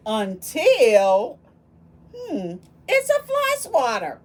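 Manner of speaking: loud and high, drawn-out until then a bright reveal; spring the surprise on the kids; dramatic reveal. Acted. Reading the story's refrain to children, loud high reveal.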